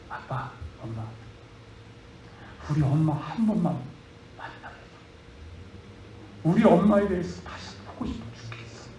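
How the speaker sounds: soft, hushed plea to her parents; barely above a breath, begging gently; quiet pleading. Acted. Daughter begs to meet mother. (조용히 간청하듯)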